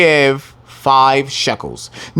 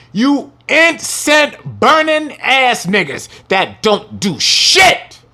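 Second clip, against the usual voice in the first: slow, high, thundering rebuke; preaching at full force; 9 semitones higher, much slower than usual. Real, not acted. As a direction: hammer each word home. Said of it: "I'ma say it again".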